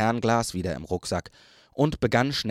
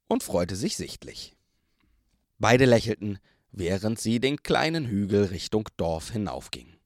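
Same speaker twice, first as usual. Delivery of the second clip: usual speaking voice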